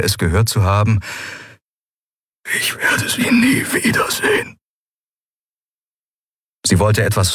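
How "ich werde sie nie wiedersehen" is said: faint, voiceless whisper; barely audible. A narrator's reading. Keru fearing he has lost her, barely audible whisper. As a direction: whisper almost to yourself. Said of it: "so leise, dass Endriel nur glaubte".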